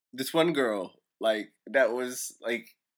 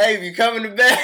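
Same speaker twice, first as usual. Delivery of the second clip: loud teasing call to bed; playful mock call; 10 semitones higher, 12 dB louder than usual. Acted quote, loud playful.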